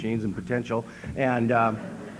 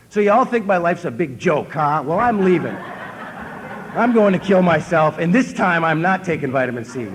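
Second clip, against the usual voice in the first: indignant outburst; 4 semitones higher, 4 dB louder than usual. Retold or imitated. Imagined outburst at the meeting.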